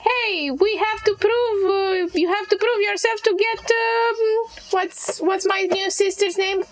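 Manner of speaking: high, silly mimicking voice; mocking imitation. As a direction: mimic them in a dumb high voice. Hag mimics other character.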